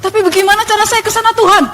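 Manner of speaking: high, quick pleading question; mock yelling. Asking God the way from the street, re-enacted yell. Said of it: "Teriak-teriak di jalan gitu".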